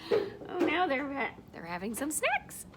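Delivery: ordinary delivery, nothing marked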